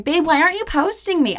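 mock clingy whine; whiny girlfriend. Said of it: "as much as I was like".

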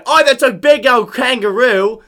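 loud, slow mock Australian accent. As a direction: drawl it like a hammed-up Aussie. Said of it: "I'm gonna do an Australian accent".

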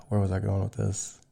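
losing the thread, quiet; drifting off mid-thought; trailing off. Real, not acted. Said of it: "went completely sideways".

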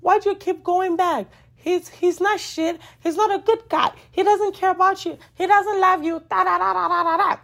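high, exasperated friend voice, pitched well up; nagging friend. Retold or imitated. Friend begging her to stop going back, high exasperated voice. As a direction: play the fed-up best friend, voice pitched high. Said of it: "your friend will keep asking you".

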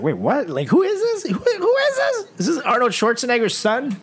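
hammed-up incredulous reaction; mock bafflement. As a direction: voice shooting up very high in disbelief. Mock bafflement at friend's macho act.

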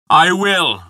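mild shout, pitched high. A narrator's reading. Giant insisting on his boast in Odin's hall, shouting. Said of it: "the giant shouted again".

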